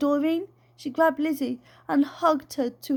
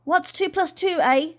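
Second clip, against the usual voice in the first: bossy auntie; 6 semitones higher, 4 dB louder than usual. Acted. Voices the aunt.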